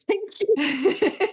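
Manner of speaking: thanks broken by a high laugh. Real, not acted. Tapping coach laughing as she thanks [name]. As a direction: laugh through the thank you. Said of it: "We're going deep".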